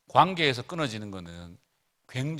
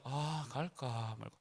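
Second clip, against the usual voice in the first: softer, slow, unsure; hesitant mumble; 13 dB quieter, much slower than usual. Retold or imitated. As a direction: wonder aloud whether to go. Son hesitating outside the gate.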